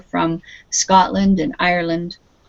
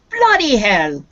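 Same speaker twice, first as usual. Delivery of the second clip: louder British-style outburst; British exclamation; 2 semitones higher, 4 dB louder than usual. Shown as a demonstration. Teacher shows students a very British expression, British exclamation. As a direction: exclaim it with a British lilt, falling away. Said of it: "It is very British".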